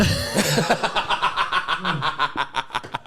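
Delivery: big burst of laughter; laughing hard. Real, not acted. Laughs at Nosy Bob.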